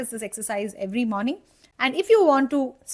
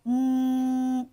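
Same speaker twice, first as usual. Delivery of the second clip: held ng hum. Shown as a demonstration. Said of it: "just hold on to that final sound".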